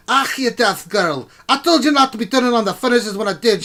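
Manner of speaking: high, loud dwarf king scolding; arguing dwarf king. Acted. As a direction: bellow at a daughter like a cranky dwarf. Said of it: "arguing with one another".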